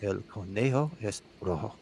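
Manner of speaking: low, soft Spanish film voice; imitating little voice. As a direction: slip in a quiet, low mysterious voice. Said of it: "the voice again goes".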